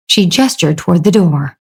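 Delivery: plain reading voice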